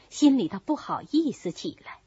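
ordinary delivery

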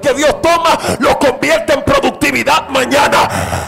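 loud, rising revival sermon; full-force preaching. Real, not acted. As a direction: drive it home to the whole congregation.

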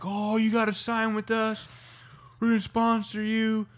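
high, excited pitch to recruit; eager sponsors. Retold or imitated. Sponsors begging surfers to sign, high-pitched retold excitement. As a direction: gush like a sponsor chasing new talent.

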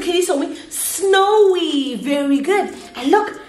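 put-on animated, drawn-out kids' lesson voice; teacher to young children. Shown as a demonstration. Teacher leading a weather game for children, animated. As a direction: sing out the words playfully to little learners.

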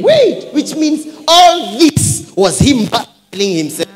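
high, loud preaching cry; full-force preaching. Real, not acted. Preacher proclaims the servant verse to church, full-force preaching.

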